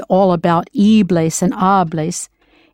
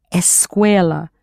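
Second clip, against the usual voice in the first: usual speaking voice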